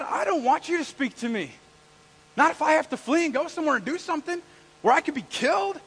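high, loud flustered objection; protesting. Acted. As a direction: plead your comforts to get out of it. Acts Moses protesting being sent.

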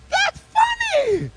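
very high, loud, drawn-out squeal; high exclamation. Real, not acted. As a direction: squeal it in comic insistence. Insists the verse is funny.

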